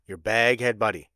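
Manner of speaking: ordinary talk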